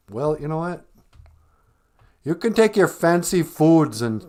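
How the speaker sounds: usual speaking voice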